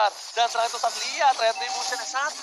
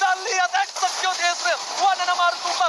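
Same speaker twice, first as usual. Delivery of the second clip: high, slowed hyped call; excited caster call; 6 semitones higher than usual. Real, not acted. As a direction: lean into the kill call. Caster calls a kill. (komentator bersemangat)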